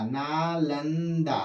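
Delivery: teacher dwelling slowly on Nalanda; slow drawn-out naming. Shown as a demonstration. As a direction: stretch Nalanda slowly, every syllable long. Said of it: "आ जाएगा नालंदा".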